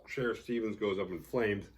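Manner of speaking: usual speaking voice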